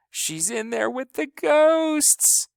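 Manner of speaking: put-on high, slow, frightened child's voice; little sister wailing about ghosts; scared wail. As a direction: sob it out in fear.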